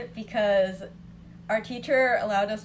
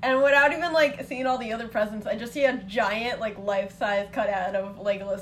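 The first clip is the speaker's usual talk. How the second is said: ordinary talk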